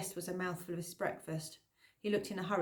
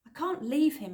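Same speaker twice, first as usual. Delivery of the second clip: higher, louder protest; 7 semitones higher, 5 dB louder than usual. A narrator's reading. Girl protesting to Ephraim, refusing to leave. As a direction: insist against being sent away. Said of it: "I protested".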